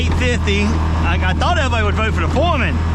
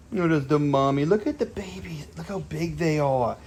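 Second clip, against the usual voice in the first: tender puppy talk; 3 semitones lower, 7 dB quieter than usual. Real, not acted. Dad showing viewers the mother and pups, tender hush. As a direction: coo gently so the pups stay calm.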